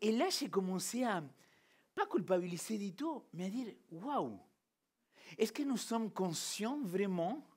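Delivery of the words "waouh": ordinary talk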